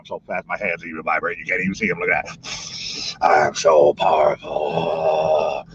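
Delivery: hammed-up low villain voice; mock powerful villain. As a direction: gloat like a cartoon overlord. Boasts power in put-on voice.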